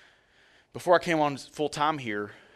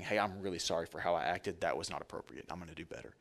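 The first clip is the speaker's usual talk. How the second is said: low, fading to near silence; soft apology; 6 semitones lower, 9 dB quieter than usual. Retold or imitated. Pastor showing the congregation how to apologize quietly. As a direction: humbly say sorry, quieter and quieter. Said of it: "the grace of God is in saying".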